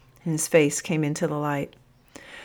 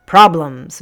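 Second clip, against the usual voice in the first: loud, booming captain; 10 dB louder than usual. A narrator's reading. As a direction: boom it out in complaint. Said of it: "boomed the captain".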